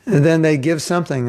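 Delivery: usual speaking voice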